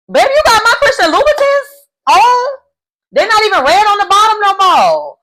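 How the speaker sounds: high, loud, hammed-up outrage; outraged bride. Bride dressed up and refused at the altar, outraged. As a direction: play a jilted bride yelling at her groom. Acted jilted bride berating groom.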